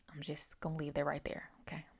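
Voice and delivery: hushed, secretive aside to the viewers; conspiratorial whisper. Real, not acted. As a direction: whisper it like you're sharing a secret tip.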